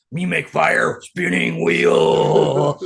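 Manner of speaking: very slow, loud caveman boast. Pretend. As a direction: grunt it out like a proud caveman. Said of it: "Me Neanderthal".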